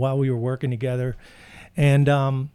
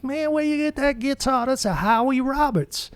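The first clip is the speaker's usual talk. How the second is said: imitating Mac; 12 semitones higher than usual. Mac asking the narrator about his guitar, pitched way up. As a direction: act surprised, like Mac spotting the guitar. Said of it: "and he goes".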